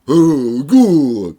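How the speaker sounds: long, very loud drawn-out mock groan; loud mocking moan. Mimicking someone after scorning lawyers and judges, loud mock moan. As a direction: belt it out as a mocking imitation.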